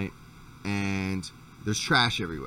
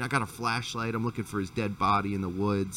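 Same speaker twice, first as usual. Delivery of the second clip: ordinary talk, nothing marked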